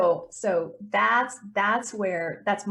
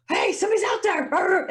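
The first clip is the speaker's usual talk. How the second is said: high, louder voice of a barking dog; dog's alert voice; 8 semitones higher, 4 dB louder than usual. Pretend. Owner voicing her dog alerting to a stranger, high and loud. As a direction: voice the dog raising the alarm. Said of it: "They're like".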